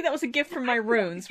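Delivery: ordinary talk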